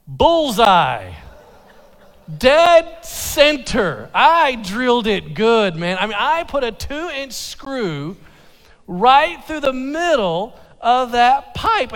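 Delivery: slow loud ironic pride at his mistake; sarcastic self-mockery. Real, not acted. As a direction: rub in how perfectly he botched it. Mocking his own perfect drilling.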